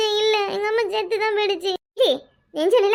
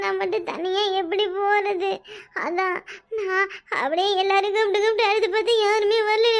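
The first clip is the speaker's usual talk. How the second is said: funeral lament. Pretend. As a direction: sob on and on, wanting to follow mother. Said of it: "விழுந்து ஒப்பாரி வைக்குது". (செத்தது போல் போலி ஒப்பாரி)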